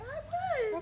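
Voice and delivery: high, squeaky, sing-song rainbow character. Pretend. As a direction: bounce it up high like a cheerful rainbow. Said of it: "You're not talking like a rainbow".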